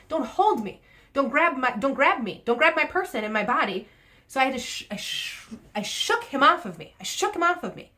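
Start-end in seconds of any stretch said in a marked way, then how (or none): 0.1-3.9 indignant protest. Retold or imitated. Retold protest at grabbing man.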